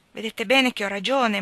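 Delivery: plain reading voice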